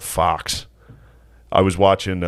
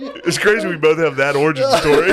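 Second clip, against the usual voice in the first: laughing; 9 semitones higher, 4 dB louder than usual. Real, not acted. Laughing while talking.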